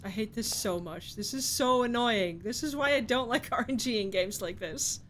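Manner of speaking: normal talking voice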